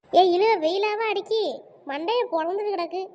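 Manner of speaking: ordinary talk, nothing marked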